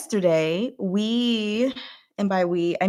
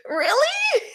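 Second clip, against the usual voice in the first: mock surprise. Retold incredulous reaction to boyfriend.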